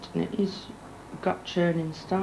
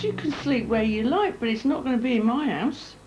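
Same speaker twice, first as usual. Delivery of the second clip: reliving the row, sharp and raised; retold fury; 6 semitones higher than usual. To the boyfriend begging to stay, mock furious dismissal.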